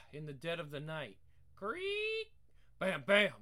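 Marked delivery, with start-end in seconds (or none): 1.6-2.3 creaky door sound. Acted. 2.8-3.3 booming bam bam, spoken loud; loud knocking sound. Acted. Knocking at the door.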